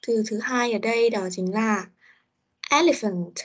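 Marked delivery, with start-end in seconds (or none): 2.7-3.3 elephant with EL punched, then falling; word-stress model. Shown as a demonstration. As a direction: stress the first syllable of elephant. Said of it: "Các từ chúng ta cần có nhấn trọng âm". (elephant nhấn mạnh âm đầu)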